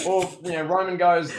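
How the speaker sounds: ordinary talk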